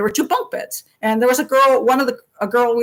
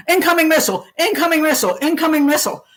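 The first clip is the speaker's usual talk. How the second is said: lightly voiced TV alarm, higher; broadcast alert voice; 5 semitones higher, faster than usual. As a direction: chant it like a TV warning. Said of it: "the television going".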